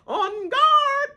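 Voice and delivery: high, loud playful war cry; hammed-up battle cry; mock battle cry. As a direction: shout it like a knight charging in. Retelling a cast member's challenge.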